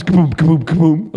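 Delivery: loud, punchy impact sounds; tumbling thud noises. Retold or imitated. Man rolling three times on the road, thudding noises. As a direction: punch out each thump of the roll. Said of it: "rolls, like, three times".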